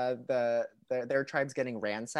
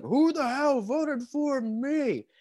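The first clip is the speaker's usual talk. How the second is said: slow high mock rage; 10 semitones higher, 3 dB louder, much slower than usual. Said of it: "he's like".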